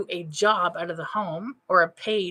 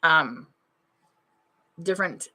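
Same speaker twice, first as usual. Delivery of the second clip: ordinary talk, nothing marked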